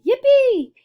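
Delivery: high, loud whoop of joy; gleeful shout. A narrator's reading. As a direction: cheer it like a roller coaster rider. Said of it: "Grandpa Joe shouted".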